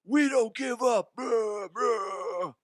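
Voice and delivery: high, loud rallying shout breaking into a roar; hyped-up Little League coach shouting; excited coach yell. Retold or imitated. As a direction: holler to fire up the team. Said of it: "I was so excited".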